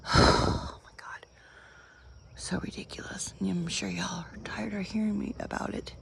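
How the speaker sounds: whispered, worn-out aside; exasperated whisper. Real, not acted. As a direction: breathe it out, fed up with the wait. Exasperated aside after a sigh.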